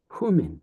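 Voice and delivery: humin with a breathy h at the start; hard breathing. Shown as a demonstration. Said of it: "Here's your hard breathing mark".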